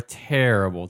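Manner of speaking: normal talking voice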